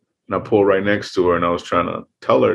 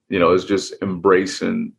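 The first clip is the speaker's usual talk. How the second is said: ordinary delivery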